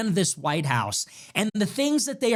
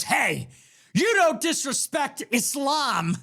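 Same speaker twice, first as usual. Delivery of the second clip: slow, higher mock-stern pundit voice; mimicking Tucker; 7 semitones higher, slower than usual. Host imitating Tucker's viral reaction, mock-stern and pompous. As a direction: play him as a pompous scold. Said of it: "He goes".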